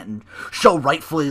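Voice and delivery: normal talking voice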